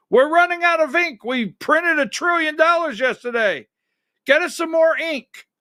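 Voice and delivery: high, fast frantic voice of a basement printer; mock panicked printer. Host voicing basement printers running out of ink, high and frantic. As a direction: squeal it like a panicked money printer. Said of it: "Picture the guys and the gals".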